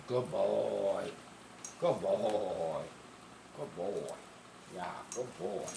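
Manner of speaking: low, soft praise for a good dog; softly praising the dog in the chair. Real, not acted. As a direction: settle him down gently.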